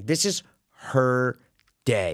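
This is, her, day, each word set apart with pauses; slow it down, word by word; spaced for emphasis. Real, not acted. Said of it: "emphatic pause".

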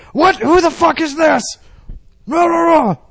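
high, loud angry yelling; furious spouse yelling down the phone; angry shouting. Pretend. Acts angry spouse answering.

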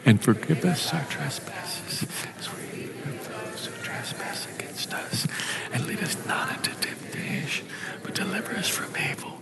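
prayer breathed out in a whisper, barely voiced. Real, not acted. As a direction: hush to a whisper as you pray the words.

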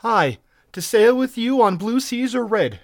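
high, loud, slow heroine's vow; passionate. A narrator's reading. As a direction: answer with ardent conviction. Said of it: "she answered passionately".

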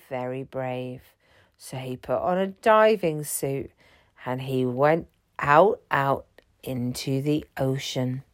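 usual speaking voice

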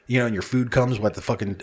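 usual speaking voice

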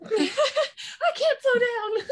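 high, drawn-out mock wail through laughter; mock frantic wail. Co-host mimics Garth's answer to Dean, mock frantic wail. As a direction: wail it slowly, laughing at the character.

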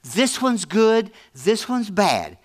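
slow, weighed out word by word; slow deliberate contrast. Acted. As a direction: lay out both routes slowly, one at a time. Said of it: "slow deliberate contrast".

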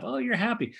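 put-on light up like a parent beaming at a baby; delighted parent. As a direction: parent beaming at a happy child, mock delight. Parent lighting up for happy child.

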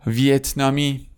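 usual speaking voice

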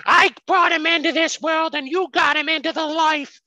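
high, hammed-up Italian-American accent; overdone New York Italian mother yelling; mock yelling mob wife. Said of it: "When she's yelling at him".

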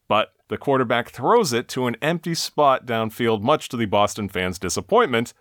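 ordinary talk, nothing marked

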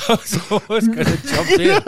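talking through real laughter; laughing. Real, not acted. Co-host who almost jumped in, laughing. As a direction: squeeze the words out between laughs. Cracking up after the yelling.

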